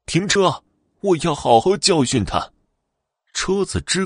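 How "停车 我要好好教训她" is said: shouted high and loud. Acted. Old ghost woman yells at the driver to stop, high and loud. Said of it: "老太太大声地叫道".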